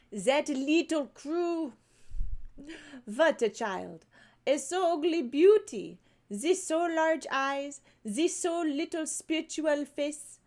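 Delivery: slow, high, heavily French teacher voice; exaggerated French accent, drawn out and admiring. French teacher praising Sarah to the music master, thick French accent. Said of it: "they wrote out the French accent on this one".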